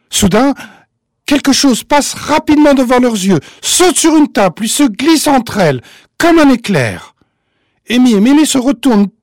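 high, loud, breathless suspense narration; excited storytelling. A narrator's reading.

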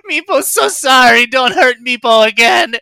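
high, loud pleading squeal; tiny creature crying out, very high; frightened little creature shouting. Acted. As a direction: beg for mercy in a panicked kobold voice. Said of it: "shouting out in".

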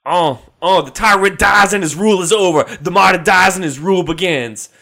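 high, loud, fast rapper delivery; hype rapper voice. Pretend. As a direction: bark it like a hyped rapper. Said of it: "Or, in its original context".

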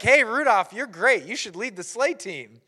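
high, loud, gushing flattery; hammed-up sudden admiration; mock enthusiastic praise. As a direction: cheer him on like a fickle crowd. Voicing reindeer suddenly praising Rudolph.

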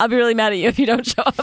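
rushed, bright fake-sweet warning; fast sugary threat.

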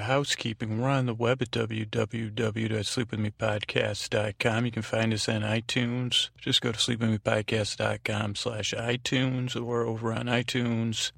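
ordinary talk, nothing marked

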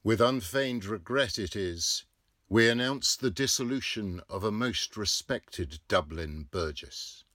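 loud formal announcement; newspaper notice read out. A narrator's reading. Narrator reads the obituary notice loudly. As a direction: declaim it like printed news.

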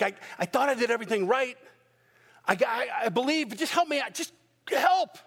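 a father pleading high and fast, rising to a cry; desperate father. Acted. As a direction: beg for help till the voice cracks into a shout. Preacher voicing desperate father.

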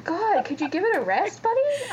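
mock exasperated telling-off; exasperated complaint. Podcaster voicing a housemate sick of Todd's drumming, exasperated.

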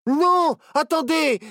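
very high, loud urgent yell. A narrator's reading. Barnabé begs the captain to wait, yelling. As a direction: yell to stop them. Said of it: "hurla Barnabé".

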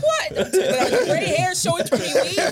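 high, loud, shocked outrage; mock shocked. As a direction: play up the shock, laughing. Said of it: "I was like".

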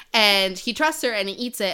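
ordinary talk, nothing marked

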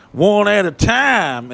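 high, loud, very slow rule reading; strict sign rule. Retold or imitated. Mimicking strict state shower signs, high and slow. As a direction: spell out the rule slowly. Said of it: "some states are like".

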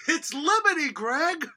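high, loud quoted line; quoting a character. Retold or imitated. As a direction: belt out the quote. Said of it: "last week we heard".

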